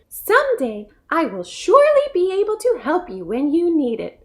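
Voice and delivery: lightly voiced earnest little mouse. A narrator's reading.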